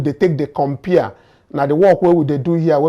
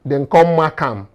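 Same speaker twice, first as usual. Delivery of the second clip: ordinary talk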